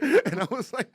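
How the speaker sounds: normal talking voice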